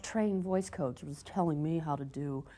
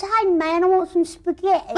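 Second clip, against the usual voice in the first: higher, louder mock American; son's American accent; 10 semitones higher, 6 dB louder than usual. Mum imitating her son's American talk, higher mock accent. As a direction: imitate a kid's American drawl, laughing. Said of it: "he kind of says".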